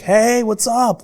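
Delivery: imitated high, long-drawn cheery greeting; breezy, nothing wrong. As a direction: greet as if nothing ever happened, high and stretched. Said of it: "like, nothing went wrong".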